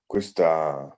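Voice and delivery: usual speaking voice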